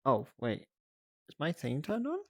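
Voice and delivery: ordinary delivery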